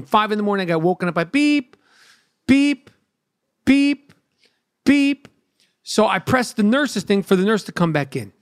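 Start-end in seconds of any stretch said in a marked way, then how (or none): 1.2-1.8 short steady electronic beep; imitated machine beep. Patient imitates the monitor that woke him, mimicked beep. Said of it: "something's beeping in my room". 2.5-5.3 high, louder monitor beeps, evenly spaced; imitated machine beep. Patient imitates the monitor that woke him, high mimicked beeps. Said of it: "something's beeping in my room".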